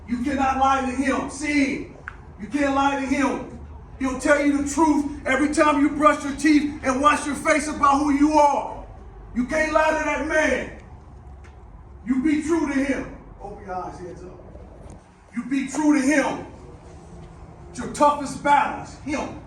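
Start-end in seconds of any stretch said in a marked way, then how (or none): 0.1-13.2 shouting, high and loud; halftime pep speech. Real, not acted. 15.3-16.5 shouting, high and loud; halftime pep speech. Real, not acted. Return to shouting peak. 17.7-19.4 shouted high and loud. Real, not acted. Loud take before soft echo.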